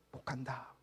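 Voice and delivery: a resigned church member, quiet and low; muttered answer. Retold or imitated. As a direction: answer under your breath, resigned. Imagined member's reluctant answer.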